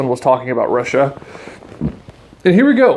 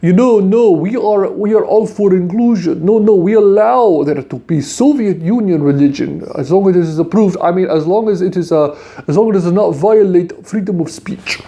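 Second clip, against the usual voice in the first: higher, foreign-sounding mocking official voice, insisting no, no; mock Soviet church official; 5 semitones higher than usual. Host mocks a Soviet-approved cleric, higher and foreign-sounding. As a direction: parody a KGB-backed cleric denying persecution. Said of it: "would trot out and say".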